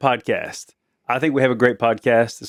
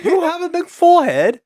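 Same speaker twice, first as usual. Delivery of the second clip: very high mock British accent. Repeating the forehead insult in jest, high mock British accent. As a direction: mimic a British accent in a high, silly pitch. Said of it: "you have to do it in a British accent".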